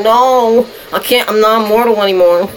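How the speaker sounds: high loud no; dismayed shout. Real, not acted. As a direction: cry out in dismay. Losing in game.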